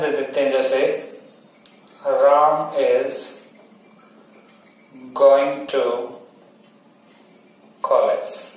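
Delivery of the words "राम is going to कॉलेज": Ram is going to said very slowly, falling on going, then college; very slow example sentence. Shown as a demonstration. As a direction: dictate the example slowly with the tune. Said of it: "यहाँ पे stress लगा दिया".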